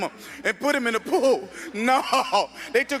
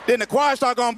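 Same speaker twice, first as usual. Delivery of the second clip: ordinary talk, nothing marked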